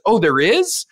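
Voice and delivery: high rising question; playfully surprised, open to believing it; interested dad. Retold or imitated. Dad hears kid about the dragon, mildly surprised.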